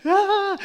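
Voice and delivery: high startled mock yelp; imitated kid's shriek. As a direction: squeal like a spooked kid. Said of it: "like, freaking out".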